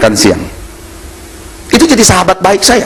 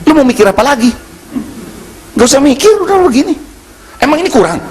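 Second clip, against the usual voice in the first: higher, insistent offer; pushy boss; 8 semitones higher than usual. Retold or imitated. Preacher voicing the bank owner pressuring him. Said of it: "Dia bilang begini".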